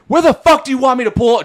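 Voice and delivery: high, loud indignant shout; yelling back. Retold or imitated. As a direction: act out shouting back at a cop. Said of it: "I started yelling back at him".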